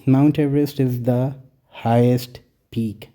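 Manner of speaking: very slow, word by word; slow example reading. Shown as a demonstration. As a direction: read the example out slowly. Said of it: "example देखें".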